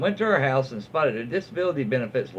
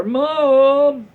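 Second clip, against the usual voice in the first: much higher, louder whine of Mom; whiny daughter; 12 semitones higher, 8 dB louder than usual. Retold or imitated. Daughter pleading with her mother to stop, high loud whine. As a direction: wail it like a mortified teen. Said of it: "Tell her daughter".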